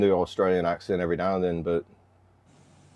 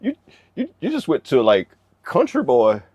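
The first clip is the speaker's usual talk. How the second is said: mock disbelief; 8 semitones higher, 3 dB louder than usual. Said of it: "it's like".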